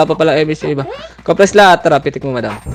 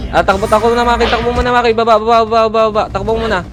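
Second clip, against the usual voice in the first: urgent calling out; 6 semitones higher, faster than usual. Real, not acted. Urges teammate to run.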